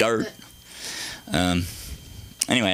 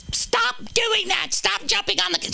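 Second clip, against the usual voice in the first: bad impression of mom. Mom catching him jumping on the couch, hammed-up high scolding. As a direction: overdo the scolding mother, way up high. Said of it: "I can't do a good impression of her".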